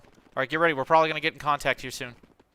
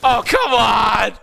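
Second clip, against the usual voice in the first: drawn-out angry yell; frustrated shout; 8 semitones higher, 10 dB louder, much slower than usual. Real, not acted. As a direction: bellow in frustration. Frustrated at squad dying.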